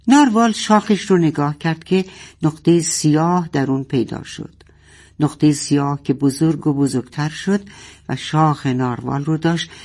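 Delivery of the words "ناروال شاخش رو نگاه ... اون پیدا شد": usual speaking voice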